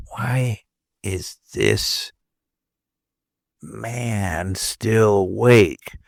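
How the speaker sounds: hushed spooky whisper. Retold or imitated. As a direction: whisper it slowly. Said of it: "they would say".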